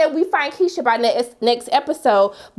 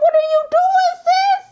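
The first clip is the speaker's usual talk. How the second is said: high, loud exasperated yell; 5 dB louder, slower than usual. Retold or imitated. Yelling at Keisha through the TV, high and loud. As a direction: shriek at her in disbelief. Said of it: "I was literally yelling".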